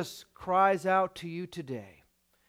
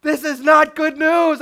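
higher, louder frustrated outburst; loud exasperation; 8 semitones higher, 10 dB louder than usual. Retold or imitated. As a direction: throw up your hands in alarm. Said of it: "You're like".

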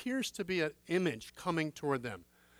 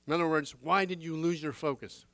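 usual speaking voice

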